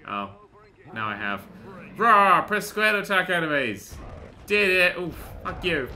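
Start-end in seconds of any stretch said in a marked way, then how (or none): 2.0-2.4 loud, high mock roar; playful monster roar, loud; Hulk roar. Roars along as the Hulk.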